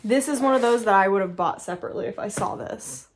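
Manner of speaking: ordinary delivery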